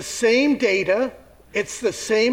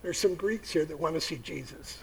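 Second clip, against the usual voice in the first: worried whisper; 2 semitones lower, 6 dB quieter than usual. Acted. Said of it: "And he goes".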